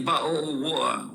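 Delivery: "ba-o-wa" with the Ts dropped; Cockney-style bottle of water, Ts swallowed; glottal British bottle of water. Shown as a demonstration. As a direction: say "ba-o-wa", no T sounds. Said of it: "without the T sound".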